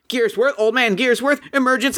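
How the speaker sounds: high, alarmed robot cry; panicking robots. Acted. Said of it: "They're like".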